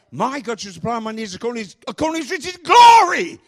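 building to a high, shouted GLORY; GLORY roared out in triumph; stress on GLORY. Shown as a demonstration. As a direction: race up through the verse and explode on "glory". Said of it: "emphasizing one word at a time".

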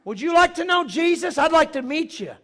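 high, very loud evangelistic holler; shouted invitation. Retold or imitated. Preacher calls the heckler to Jesus, shouted invitation. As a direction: yell a friendly offer up at a window.

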